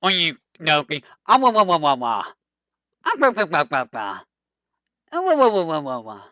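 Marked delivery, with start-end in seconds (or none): 1.3-6.2 mock "wah wah" wails falling away; imitating her wailing. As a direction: wail it in little falling bursts, playfully. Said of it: "You know what I'm trying to do".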